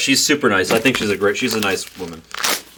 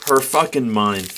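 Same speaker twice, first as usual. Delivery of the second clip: ordinary talk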